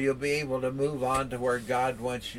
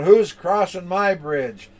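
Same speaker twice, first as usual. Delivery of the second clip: slow, louder troll roar; 4 semitones higher, 7 dB louder than usual. Acted. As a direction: roar the question slowly like a troll. Troll challenges the big goat.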